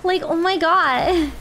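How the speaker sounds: put-on drawn-out valley girl gasp; ditzy valley girl, slowly. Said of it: "I added a Valley Girl Redemption".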